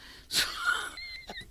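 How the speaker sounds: a real high laugh. Real, not acted. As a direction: laugh off the false alarm. Punchline about the cutlery box.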